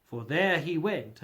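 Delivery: ordinary delivery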